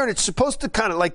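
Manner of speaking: ordinary talk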